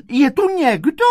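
high, loud call. A narrator's reading. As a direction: call anxiously down the hallway. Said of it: "začala volat". (volá nahlas vysokým hlasem)

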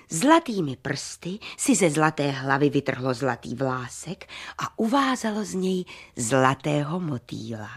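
plain reading voice

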